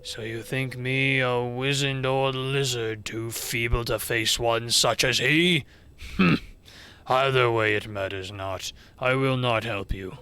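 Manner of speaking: low, haughty dragon voice; grave, measured disdain; affronted old dragon. Acted. Old dragon refuses the visitors, low and offended.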